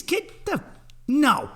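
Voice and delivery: ordinary talk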